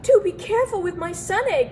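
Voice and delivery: anxious call; worried cry. A narrator's reading. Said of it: "cried the elf".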